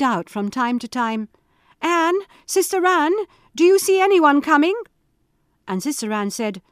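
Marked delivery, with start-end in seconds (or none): 1.8-4.7 high, loud calling upward; anxious call. Acted. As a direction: call up the tower desperately. Wife calls up the tower.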